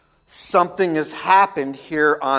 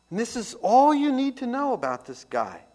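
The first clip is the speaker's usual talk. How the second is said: ordinary delivery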